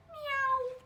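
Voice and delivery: high loud meow. Acted. Dog meows loudly. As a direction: meow like a cat. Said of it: "the dog said".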